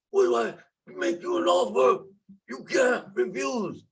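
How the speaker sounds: mock the Godfather's famous offer, playfully. Vlogger jokingly quotes the Godfather to viewers, hammed-up mobster voice. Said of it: "Call me the Wick father".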